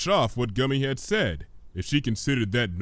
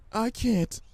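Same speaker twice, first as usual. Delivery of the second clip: small, higher, hesitant voice; timid Fluttershy; 11 semitones higher than usual. Acted. Fluttershy refuses the vendor, timid and higher. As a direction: shrink from the question.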